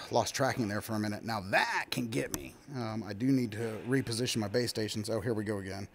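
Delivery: ordinary delivery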